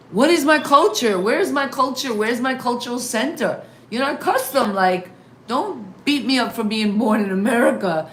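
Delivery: mock frustrated teen shouting at parents; exasperated outburst. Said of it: "I'm like".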